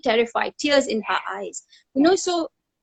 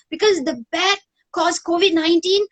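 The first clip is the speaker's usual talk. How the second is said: high, frightened child voice, imitated; daughter's scared words; 6 semitones higher than usual. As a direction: mimic a terrified little girl. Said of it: "She's like".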